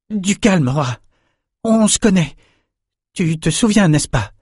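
high-pitched, coaxing appeal; calming plea. A narrator's reading. Young swordsman to the panther advancing on him, pleading calm. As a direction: soothe a dangerous beast, urgently.

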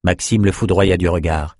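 plain reading voice

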